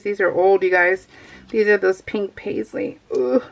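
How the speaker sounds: ordinary talk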